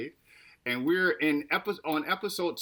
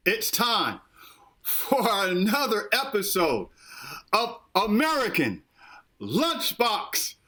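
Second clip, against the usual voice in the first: high, drawn-out showman intro; cartoon intro announcer; 7 semitones higher than usual.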